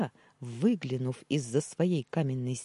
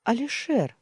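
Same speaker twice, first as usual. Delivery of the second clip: higher, louder call through the door; 9 semitones higher, 5 dB louder than usual. Acted. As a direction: call his name from the doorway. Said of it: "позвала сестра".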